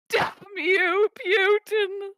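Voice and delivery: high, loud mock curse; mock outrage. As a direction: curse your rival in hammed-up outrage. Mock cursing after being rickrolled.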